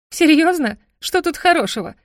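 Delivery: indignant outburst. Acted. Heroine furious at demon.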